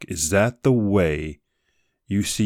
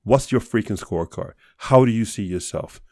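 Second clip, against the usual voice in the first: normal talking voice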